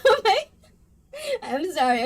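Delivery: sorry through the giggles; real laughter; apologetic laughing. Real, not acted. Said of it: "I can't stop laughing".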